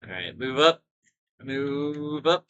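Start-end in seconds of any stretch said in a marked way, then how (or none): 0.4-0.8 raised, rising call to troops; calling an order. Pretend. Player ordering game troops forward, calling out. 1.4-2.4 high, stretched call with a rising end; drawn-out order. Pretend. Player urging game troops on, drawn-out call.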